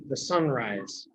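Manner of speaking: ordinary delivery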